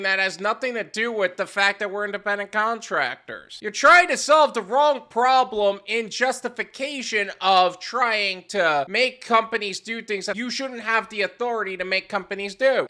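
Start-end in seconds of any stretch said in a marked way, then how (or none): none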